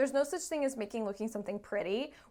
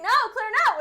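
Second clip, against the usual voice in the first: high, loud imitated panic; hammed-up high, loud objection; mock protest; 12 semitones higher, 10 dB louder, faster than usual. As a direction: protest like a cornered client. Acts out clients' protest.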